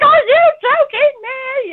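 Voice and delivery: very high, slow, drawn-out shocked cry; stunned as he pulls out the ring; stunned disbelief. Retold or imitated. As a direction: let the disbelief stretch every word.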